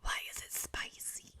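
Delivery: breathy whisper instead of a demon voice; whispered attempt. Shown as a demonstration. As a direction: try it in a whisper. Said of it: "I can't do the demon voice for that".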